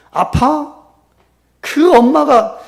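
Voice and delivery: high, loud, incredulous wife; angry retort. Acted. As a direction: throw it back at your husband, loudly. Wife snapping back at husband.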